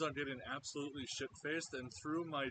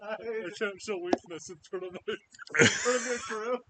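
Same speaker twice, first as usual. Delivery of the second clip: mock crying; 9 semitones higher, 12 dB louder than usual. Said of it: "Are you crying, Captain?".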